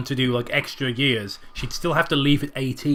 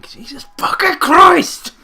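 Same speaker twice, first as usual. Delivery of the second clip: much higher and louder, slow; shocked shout; 14 semitones higher, 10 dB louder, slower than usual. Real, not acted. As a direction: blurt it out in total shock. Shocked reading a twist.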